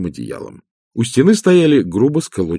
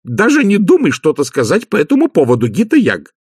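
sharp, higher-pitched scolding; snapped warning; 9 semitones higher, 4 dB louder than usual. Acted. Magrat forbids Nanny Ogg a remark, snapping in a raised pitch. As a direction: cut her off before she can speak. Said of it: "Маград рявкнула на неё".

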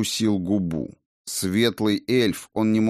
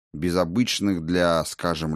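ordinary delivery, nothing marked

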